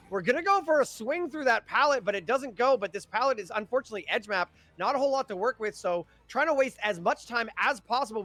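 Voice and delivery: energetic, rolling esports commentary, pitch lifted; live play-by-play. Real, not acted. Caster narrating a chase for viewers, live play-by-play. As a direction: call the chase as it unfolds, urgency up.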